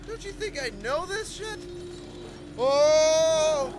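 exasperated yell. Real, not acted. Frustration then scare.

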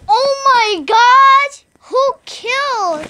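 high, loud, slow shocked cry; shocked gasp. Acted. Player discovering a body, shocked cry.

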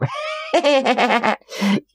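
a big rolling laugh; hearty laugh. A narrator's reading. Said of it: "заливаясь смехом".

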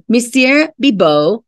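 ordinary delivery, nothing marked

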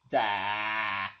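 ordinary talk

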